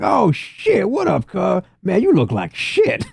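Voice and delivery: street gang greeting. Acted. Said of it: "the O Gs are like".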